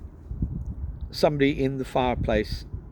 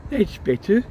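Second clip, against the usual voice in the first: mild panting; 10 semitones higher, 5 dB louder than usual. Acted. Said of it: "panted Mister Weasley".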